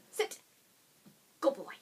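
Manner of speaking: ordinary talk, nothing marked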